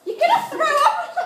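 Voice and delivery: laughing shout. Real, not acted.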